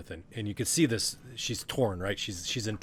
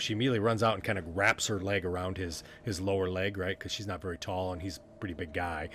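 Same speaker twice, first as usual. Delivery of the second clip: ordinary delivery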